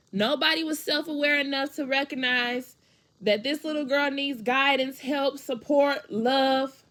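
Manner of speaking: high, loud, emotionally charged plea; pained insistence. Real, not acted. Woman saying her younger self needed guidance, pained insistence.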